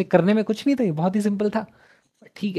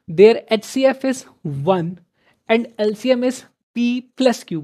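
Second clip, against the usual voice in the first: slow, louder question read; 4 semitones higher, 4 dB louder, slower than usual. Shown as a demonstration. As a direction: spell the statement out word by word. Reads question aloud slowly. (प्रश्न को धीरे-धीरे ज़ोर से पढ़ना)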